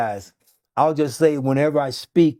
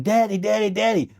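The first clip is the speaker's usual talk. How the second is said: retold scream; 7 semitones higher than usual. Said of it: "was screaming".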